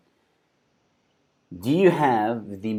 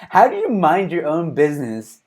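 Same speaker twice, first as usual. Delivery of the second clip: louder, raised-pitch disbelief; incredulous question; 5 semitones higher, 6 dB louder than usual. Real, not acted. Host reacting to the heckler's retort, loudly incredulous. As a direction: ask it as loud, baffled objection.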